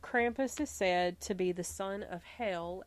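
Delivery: usual speaking voice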